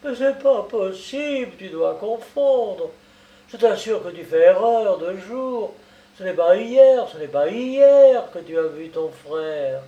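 put-on worked-up old man contradicting; nervous insistence. A narrator's reading. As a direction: insist anxiously, stressing the key word. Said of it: "Le vieux caissier insista nerveusement".

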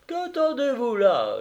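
high, loud call across the yard. Acted. Praskovia calls to the suitor at the gate, high and loud. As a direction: call him in from the door. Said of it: "lui cria Paskovia".

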